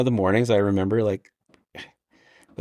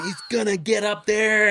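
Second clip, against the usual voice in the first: higher, slower proud voice; imitating Paskey; 10 semitones higher than usual. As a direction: cheer him on as the brother. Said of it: "Paskey is talking about Martin".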